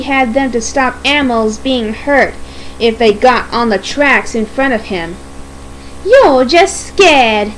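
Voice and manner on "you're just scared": slow, drawn-out taunt; rude. Acted. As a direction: taunt rudely, stretching the words. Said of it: "Daisy said rudely".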